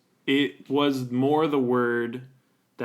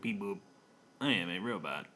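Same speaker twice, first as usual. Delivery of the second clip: low flat robot voice; 5 semitones lower, 8 dB quieter than usual. Pretend. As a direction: beep boop like a little robot. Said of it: "You do have a robot voice".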